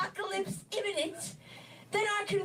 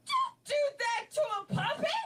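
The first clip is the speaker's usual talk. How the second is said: retold shout; 6 semitones higher than usual. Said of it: "I said".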